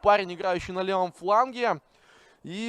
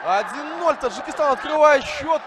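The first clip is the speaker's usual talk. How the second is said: excited; 6 semitones higher, 6 dB louder than usual. Real, not acted. Goal call continues.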